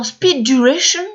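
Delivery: English term in a French accent. Real, not acted. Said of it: "mon accent anglais, il est pas très bon". (anglais avec l'accent français)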